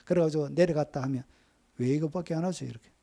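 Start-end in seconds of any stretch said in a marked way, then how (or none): 1.8-2.7 softer, mildly blunt jab; tossed off curtly in imitation; blunt retort. Preacher imitating a senior pastor's blunt question, softly curt. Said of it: "퉁명스럽게 한번 던지면".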